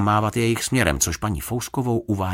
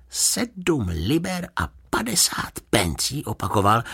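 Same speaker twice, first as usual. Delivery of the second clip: slow, high-pitched disbelief; incredulous; 9 semitones higher, slower than usual. A narrator's reading. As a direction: repeat it slowly, unable to believe your ears. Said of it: "nemohl uvěřit svým uším". (nevěřícně, pomalu a vysoko)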